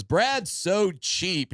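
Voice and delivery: drawn-out, slow roast jab; slow sneering roast line; slow mock roast. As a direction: drag out Brad's so cheap like a mean heckler. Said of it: "the fourth person goes up and goes".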